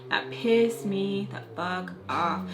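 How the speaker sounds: every word spaced out at half speed; slow, emphatic. Real, not acted. Telling viewers how angry she was, slow and emphatic. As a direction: drag out "pissed me the fuck off". Repeats line slowly for emphasis.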